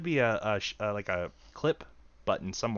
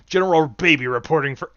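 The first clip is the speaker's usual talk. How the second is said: lightly voiced baby playing a general; a hint of a toddler barking a report; 8 dB louder than usual. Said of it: "The baby is the general".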